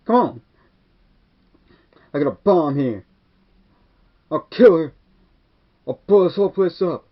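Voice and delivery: high, loud, panicky villain threats; shrill movie bomber screaming; frantic yelling threat. Retold or imitated. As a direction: yell it like a cornered madman.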